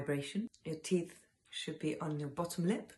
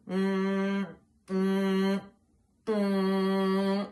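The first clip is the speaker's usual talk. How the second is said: loud, higher hum in the nose; nasal hum; 4 semitones higher, 7 dB louder, much slower than usual. Shown as a demonstration. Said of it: "should be completely in the nose".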